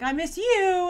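a syrupy parody of a farewell; mock sentimental. Mocking Mary's message, in mock sentimental tones.

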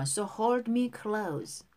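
slow, careful model reading for repetition; pronunciation model. Shown as a demonstration. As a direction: say each phrase slowly for learners to copy. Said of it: "발음 다시 한번". (따라 읽도록 천천히 또박또박)

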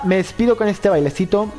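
normal talking voice

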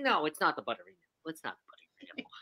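whispering, voice almost gone; barely voiced, losing her voice on a cruise; hoarse, lost voice. Real, not acted. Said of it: "I have lost my voice".

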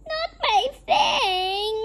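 high, loud, drawn-out wail; slow storybook wail of protest; anguished cry. A narrator's reading. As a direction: cry out in hammed-up dismay. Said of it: "Louis cried".

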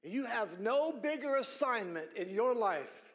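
ordinary talk

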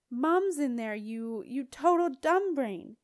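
loud, slowed-down angry insult; angry yelling. A narrator's reading. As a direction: hurl the insult in exasperation. Jenna insults him in yelled argument.

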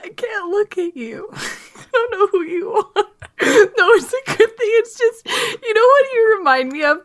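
high, squeaky, laugh-choked voice; helpless laughter. Real, not acted. Telling co-host she can't look at him, helpless laughing. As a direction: barely get it out through real giggles. Giggling fit at co-host's new face.

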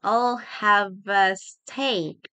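very slow, stretched syllables; slow teaching pronunciation; slow model. Shown as a demonstration. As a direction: draw each word out for learners. Teacher models phrase slowly.

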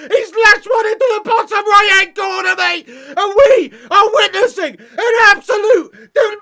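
high, loud anguished outburst; despairing shout. Real, not acted. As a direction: yell in disbelief as your team collapses. Despairing live reaction to third goal conceded.

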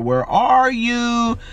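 ordinary talk, nothing marked

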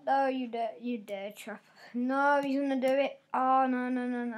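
ordinary delivery

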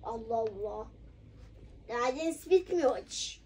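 weary quiet scolding. Pretend. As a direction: scold under your breath, worn out. Scolding goes soft and low.

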